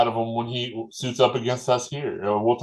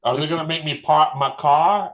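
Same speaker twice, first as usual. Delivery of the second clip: mock Boston accent, a bit louder; horrible Boston accent; 4 semitones higher, 4 dB louder than usual. Joking about the Boston College game in a bad Boston accent. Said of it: "that was a horrible Boston accent".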